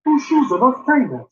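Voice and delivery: slow, puzzled Welsh lilt; bewildered Welsh, drawn out; confused Welsh accent. Shown as a demonstration. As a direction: sound baffled in a Welsh accent.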